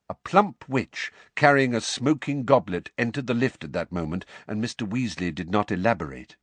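ordinary delivery

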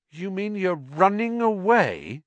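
Harry can't believe his teacher is fleeing; disbelieving. Acted. Boy asks teacher, shocked and disbelieving. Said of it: "said Harry disbelievingly".

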